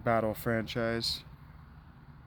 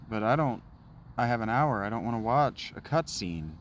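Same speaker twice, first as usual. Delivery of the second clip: ordinary delivery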